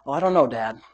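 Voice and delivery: low, soft reluctant mumble; mock reluctant kid. Son answering Dad's call to praise, mock reluctant. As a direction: play a hesitant son, dropped low. Voicing son's imagined reluctance.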